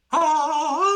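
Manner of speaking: high, loud drawn-out "ah"; last-breath cry. Acted. Game master voices Fruby's one last breath, high wail. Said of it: "takes one last breath and goes".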